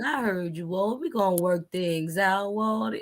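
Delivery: low, slow and cool imitation; laid-back gangster. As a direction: play it calm and collected, drawling low. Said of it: "they so laid back and cool, calm, and collected".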